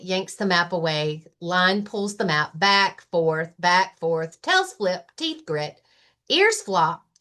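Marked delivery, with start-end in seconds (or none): none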